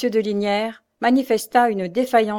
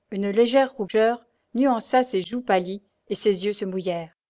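plain reading voice

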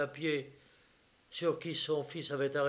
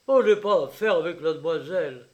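louder, brisk, emphatic refusal; loud denial; 2 semitones higher, 10 dB louder, faster than usual. A narrator's reading. Father rebuffing his son about the demoiselle, loud denial. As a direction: blurt it out forcefully and quickly.

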